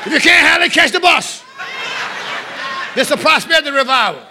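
high, loud revival cry; exultant preaching shout. Real, not acted. Preacher announcing a prosperity revival, exultant full-force shout. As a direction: burst out and throw it at the crowd.

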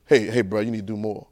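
low, soft mirror talk; telling yourself in the mirror, low and soft; quiet self-talk. Acted.

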